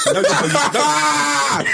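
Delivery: high, drawn-out no, no, no through laughter; mock protest while laughing; laughing denial. Real, not acted. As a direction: laugh off the claim in disbelief. Denying a friend's claim, laughing.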